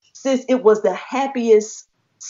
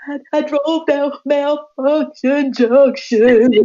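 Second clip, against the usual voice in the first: high, drawn-out mock wail; imitated crying; 6 semitones higher, much slower than usual. Telling Brother Kemet about the drive, mock crying. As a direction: blubber it slowly, hamming up the tears. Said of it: "I was crying".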